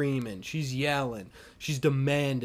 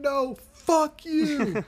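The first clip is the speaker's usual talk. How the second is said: high, drawn-out mock outburst; hammed-up indignant retort; defiant refusal; 10 semitones higher, much slower than usual. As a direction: stretch it out like an irate woman. Acting woman refusing water.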